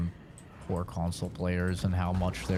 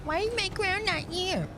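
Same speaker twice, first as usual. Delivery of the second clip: 1 whiny. Acted. Character voice in game.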